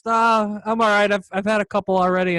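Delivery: imitating him, high and loud; retold friendly refusal. Musician turning down a fan's offer of beer, imitated refusal.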